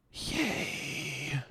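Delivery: big gasp, then a high, soft "yay"; excited mock reaction. Streamer acting out being recognised by a viewer, delighted gasp.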